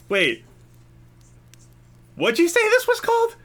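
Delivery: sudden squeaky high voice of a tipsy woman; high drunk woman. Acted. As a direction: shoot way up high, wide-eyed and tipsy.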